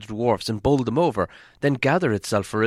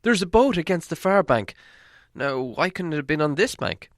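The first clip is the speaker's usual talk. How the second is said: excited cry; 7 semitones higher than usual. A narrator's reading. Hobbit to the dwarves, spotting a boat, high excited cry. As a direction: call out the discovery in a high, eager voice.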